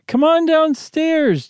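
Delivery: high, slow, wavering call. Acted. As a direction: call upstairs with a shaky voice. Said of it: "Janet called in a wavering voice".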